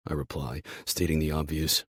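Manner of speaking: plain reading voice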